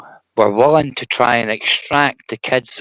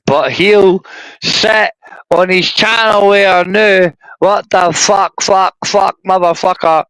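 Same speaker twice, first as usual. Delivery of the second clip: raised, drawn-out exasperated swearing; exasperated cursing; 6 semitones higher, 4 dB louder, slower than usual. Real, not acted. Ranting to his co-host about the streamer, exasperated cursing. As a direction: let the frustration spill into curses.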